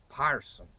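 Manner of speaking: "parson" with open AR vowel and sounded R; Shakespearean pronunciation. Shown as a demonstration. Historian showing Shakespeare's way of saying person, "parson" with open AR. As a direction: say "parson" for person, the old English way. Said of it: "Shakespeare would have said".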